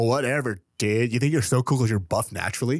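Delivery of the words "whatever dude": bored whatever. Retold or imitated. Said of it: "We're like".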